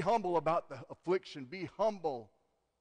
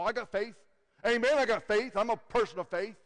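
mock pious boasting; 8 semitones higher, 9 dB louder than usual. Mocking churchgoers claiming faith.

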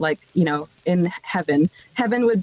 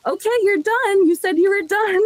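high, pressing insistence; insistent auntie; 10 semitones higher than usual. Retold or imitated. Auntie come to collect her niece, insistent. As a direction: imitate an auntie holding you to your word.

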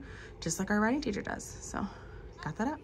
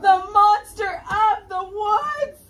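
much higher, louder, slow frightened cry; mock terror; 12 semitones higher, 11 dB louder, much slower than usual. Teacher playing scared of the monster with the class. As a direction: gasp out the name in horror.

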